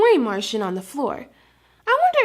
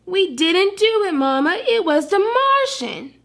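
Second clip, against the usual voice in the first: defensive puppies; 7 semitones higher than usual. Acted. Puppies answer Mama defensively.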